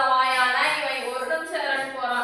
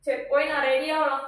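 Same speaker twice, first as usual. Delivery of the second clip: ordinary talk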